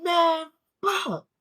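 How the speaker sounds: loud, high frustrated yell; angry curse. Retold or imitated. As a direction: vent the anger out loud. Venting rage at bad news.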